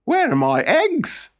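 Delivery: high, slow, demanding cook voice; a bossy cook wanting her eggs; demanding royal cook. Acted. The royal cook demanding eggs from Thornogson, high and bossy.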